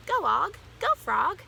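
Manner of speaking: high, loud urging shouts; cheering yell. A narrator's reading. Hamster urging on the frog he rides, yelling. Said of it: "I yelled".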